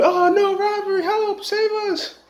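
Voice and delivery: high, hammed-up fake panic; shrieking for help in a faked robbery; mock screaming woman. Woman faking a robbery for the cops, high mock screams. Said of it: "she started screaming".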